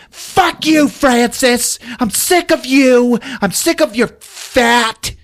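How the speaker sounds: high-pitched mock tirade; hammed-up fury. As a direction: rant at a friend you're sick of, shrieked high. Acted quarrel, Jennifer rages at Francis.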